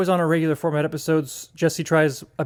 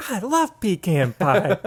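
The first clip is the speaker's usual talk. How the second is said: higher, slow imitation of a sitcom dad; hammed-up catchphrase; 4 semitones higher, slower than usual. Siblings repeating their inside joke, hammed-up catchphrase. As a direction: draw out the silly line playfully. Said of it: "we would go around saying".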